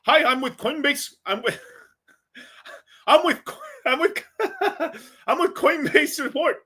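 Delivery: high-pitched mock Indian accent, breaking into laughter. Fake Coinbase support agent calling a customer, mock Indian accent. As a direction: put on a high Indian voice and keep cracking up. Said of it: "I'm trying to do my best Indian voice".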